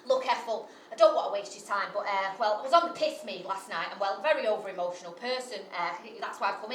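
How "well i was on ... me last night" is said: usual speaking voice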